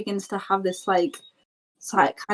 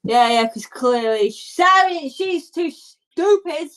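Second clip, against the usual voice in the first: put-on kid's sneer climbing higher; a hint of a dismissive brother; lightly voiced scornful boy; 5 semitones higher, 3 dB louder, slower than usual. Boy mocks girl to the traveler, scornful kid voice. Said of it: "and he goes".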